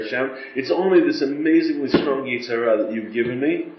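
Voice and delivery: ordinary delivery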